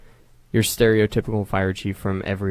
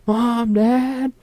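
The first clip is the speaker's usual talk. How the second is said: high, drawn-out call for the parents; retold yell; 14 semitones higher, 4 dB louder than usual. Kid calling his parents through the open window, retold yell. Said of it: "so I just yelled".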